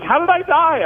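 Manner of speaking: very high, incredulous mom voice; mock appalled mom. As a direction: demand it as an offended mother. Mom demands details of death.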